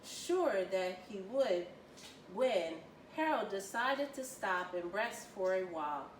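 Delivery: ordinary delivery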